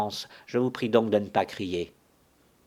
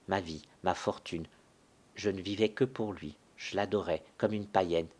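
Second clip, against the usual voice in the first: ordinary talk, nothing marked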